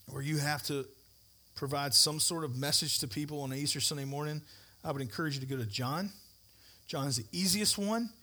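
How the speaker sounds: ordinary talk, nothing marked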